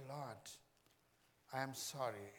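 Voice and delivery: soft confession. Real, not acted. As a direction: hush it like a private confession. Soft intimate confession, whisper at end.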